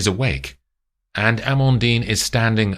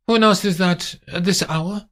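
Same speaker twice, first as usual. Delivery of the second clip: high, lightly irritated lady of the house; a haughty woman annoyed at the late knock; high-pitched indignant question; Amandine's voice; 12 semitones higher than usual. Acted.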